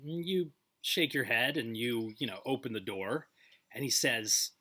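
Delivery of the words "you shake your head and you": usual speaking voice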